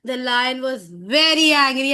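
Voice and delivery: storyteller leaning hard on VERY; demonstrated angry narration; showing anger. Shown as a demonstration. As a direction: punch out VERY ANGRY to startle a child. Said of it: "when we tell like this".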